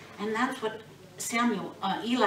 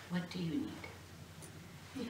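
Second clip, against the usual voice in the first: humble quiet question; 10 dB quieter, much slower than usual. Acted. Boy answering the Lord humbly.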